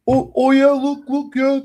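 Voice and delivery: high, loud mock-eager student voice; a caught kid scrambling to look busy; fake eager kid. Idle kid fakes being busy.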